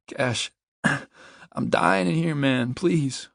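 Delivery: high, drawn-out plea; desperate begging. A narrator's reading. Trapped man says he's dying inside, begging desperately. He begs.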